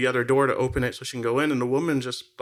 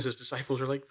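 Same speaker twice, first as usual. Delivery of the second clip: ordinary talk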